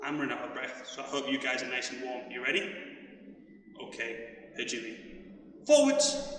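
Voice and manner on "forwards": high loud call; shouted command. Real, not acted. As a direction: call the move out. Coach calling drill directions.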